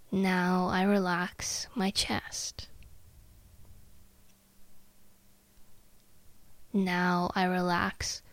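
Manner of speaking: slow, soft, sleepy self-talk; drowsy voice. Shown as a demonstration. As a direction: let each phrase sink, drowsy and slow. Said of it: "use your most comfortable, tired, drowsy voice".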